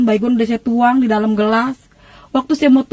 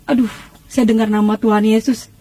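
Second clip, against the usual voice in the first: ordinary talk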